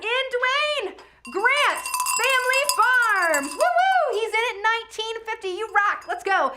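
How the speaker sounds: high, loud, drawn-out cheer; whooping excitement. Real, not acted. Auctioneer cheers a last-minute bid, whooping shout. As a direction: whoop and holler for the bidder.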